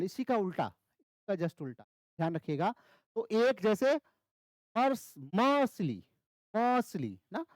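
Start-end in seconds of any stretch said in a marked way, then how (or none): 4.8-6.0 slow मर्सली, heavy on the opening म, light ली; stress on first syllable. Shown as a demonstration. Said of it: "म पे ज्यादा जोर आ रहा है". 6.6-7.2 मर्सली with a long, strong म and weak ली; stress on first syllable. Shown as a demonstration. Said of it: "म पे ज्यादा जोर आ रहा है".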